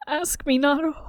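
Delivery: high tearful plea; weeping. A narrator's reading. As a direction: weep the words, begging. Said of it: "he weeps".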